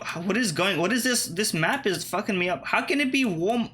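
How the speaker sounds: ordinary talk, nothing marked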